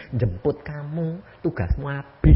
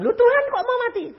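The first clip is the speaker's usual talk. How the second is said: fast protesting cry; faster than usual. Retold or imitated. Uncle protests to the Lord.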